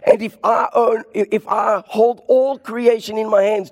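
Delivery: preacher's high shouted climax as God; booming God voice. Acted. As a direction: thunder it out as God. Climax of God's voice.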